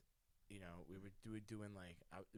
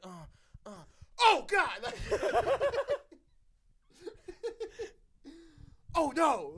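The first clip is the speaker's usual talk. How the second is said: high, loud "oh, oh, God" with laughter; retold shout; 16 dB louder than usual. Telling a friend how they both shouted at the rat, laughing. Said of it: "I shouted".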